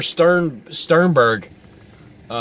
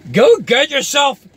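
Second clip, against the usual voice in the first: higher, louder burst of disbelief; exasperated yell; 11 semitones higher, 4 dB louder than usual. Real, not acted. Yelling at the doom guy to calm down, higher and louder. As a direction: blurt it out, fed up.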